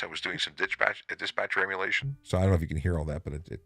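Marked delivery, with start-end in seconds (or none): 0.0-2.2 radio dispatcher through a filter. Shown as a demonstration. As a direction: emulate a dispatcher over the radio. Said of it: "doing a dispatcher voice".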